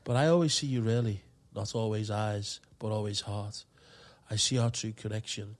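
heartfelt poem recital; poem reading. Acted. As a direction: read it slow and sincere. Reads love poem aloud.

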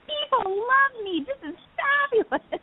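high, slow, grand diva voice. Retold or imitated. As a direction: play a delighted little diva. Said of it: "I was like".

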